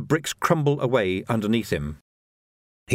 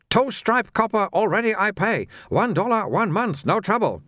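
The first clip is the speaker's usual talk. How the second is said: high-pitched trader in broken English; protesting little shopkeeper; 9 semitones higher than usual. A narrator's reading. Shopkeeper protests to a watchman about paying, high indignant voice.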